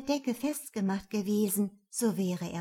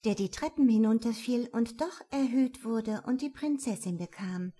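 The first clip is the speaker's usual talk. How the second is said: usual speaking voice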